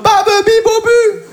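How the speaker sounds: put-on high, slow baby babble; imitating baby talk at therapy; mock babbling. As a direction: babble like a toddler. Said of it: "J'arriverai en mode".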